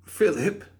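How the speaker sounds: baffled, stumbling; high, confused rising question; stammered question. Acted. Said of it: "the guard stammered".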